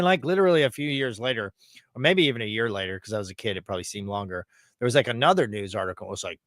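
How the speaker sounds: usual speaking voice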